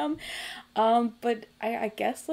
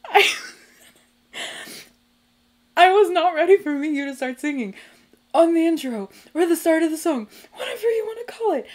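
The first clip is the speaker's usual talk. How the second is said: overwhelmed, near tears; 2 semitones higher, 5 dB louder than usual. Real, not acted. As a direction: exclaim it as if about to cry. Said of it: "I'm gonna cry".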